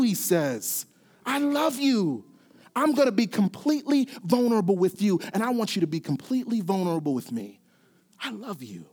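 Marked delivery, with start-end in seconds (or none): 1.3-8.9 preaching fading to tenderness. Acted. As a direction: let the plea melt into a near-whisper. God's invitation preached, fading soft.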